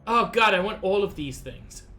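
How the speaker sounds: ordinary talk, nothing marked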